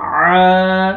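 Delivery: आ as a long Arabic ain from the throat; proper ain. Shown as a demonstration. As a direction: start आ in the throat and hold it. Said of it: "आइन को अपने मखरज से अदा". (आ को आइन के मख़रज से, खींचकर)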